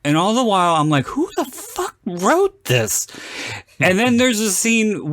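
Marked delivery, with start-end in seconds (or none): 1.1-3.1 slow, very high incredulous question; exasperated disbelief. Retold or imitated. Viewer reacting to clunky film dialogue, exasperated disbelief. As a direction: draw it out high, appalled at the writing.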